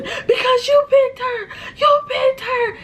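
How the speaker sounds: high, slow wail through mock sobs; mock wailing yell. Sister yells at brother outside, high mock sobbing wail. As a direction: wail it like a hammed-up tantrum. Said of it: "she's yelling".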